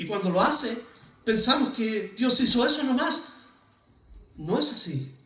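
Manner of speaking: ordinary talk, nothing marked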